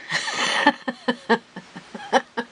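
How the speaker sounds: real burst of high laughter; helpless laughing. Real, not acted. Crafter laughs at her own mishap, helpless laughter. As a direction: crack up after a fright. Breaks up after a startling mishap.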